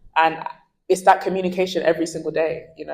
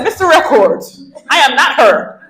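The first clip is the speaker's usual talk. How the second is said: louder, higher mock-stern correction; firm retort; 7 semitones higher, 8 dB louder than usual. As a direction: set him straight, half joking. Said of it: "I said".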